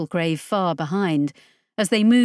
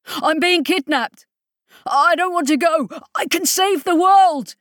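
high, loud frantic plea; panicked pleading; 9 semitones higher, 5 dB louder than usual. A narrator's reading. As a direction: beg in rising panic.

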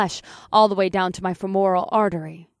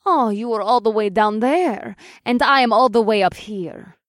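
taunting; 2 semitones higher, 4 dB louder than usual. A narrator's reading. Superheroine taunts the hero from the roof edge, taunting. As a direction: jeer down at a rival below. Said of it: "The Russian superheroine taunted".

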